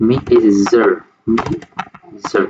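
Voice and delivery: "মি" and "জার" split apart, buzzing zh on "জার"; demonstrated ZH word. Shown as a demonstration. Teacher breaking down an example word, separated "মি" and "জার". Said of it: "এরকম".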